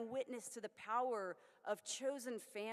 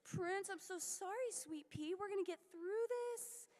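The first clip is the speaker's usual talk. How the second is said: much higher, sing-song pet voice; baby talk to pet; 10 semitones higher than usual. Retold or imitated. Comforting the constipated kitten in high baby talk.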